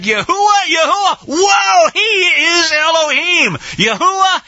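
stretched, high-pitched cries of awe; awestruck crowd cry. Acted. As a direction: shout it out in acted amazement. Acting awestruck crowd crying out.

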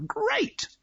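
Great said high and soft, falling; high mock great. Shown as a demonstration. Preacher mocking the view, high soft Great. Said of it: "sarcastic echo of own remark".

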